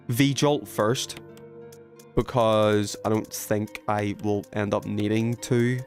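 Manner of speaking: ordinary delivery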